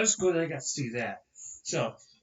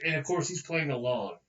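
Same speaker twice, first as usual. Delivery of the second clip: ordinary talk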